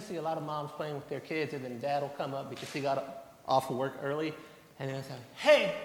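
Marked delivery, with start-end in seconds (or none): 5.4-5.7 loud, high call across the park; imitating a dad hailing his kid. Dad spotting his child at the park, loud call.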